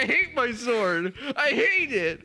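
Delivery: slow, high, whining complaint; whiny high voice. Retold or imitated. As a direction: whine it out like a broken man. Voicing the broken sword god.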